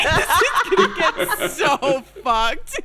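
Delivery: slow, laughing warning. Real, not acted. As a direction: giggle through the threat.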